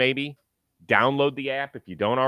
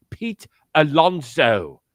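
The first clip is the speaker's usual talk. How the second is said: slow, crisp British commentator read of "Pete Alonso"; British announcer accent; 4 semitones higher, 8 dB louder, much slower than usual. Retold or imitated. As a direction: savour "Pete Alonso" like a British play-by-play man. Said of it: "I even loved the way he said".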